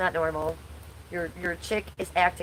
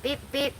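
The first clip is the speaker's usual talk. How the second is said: playful beep sound; 8 semitones higher than usual. Pretend. Playful aside.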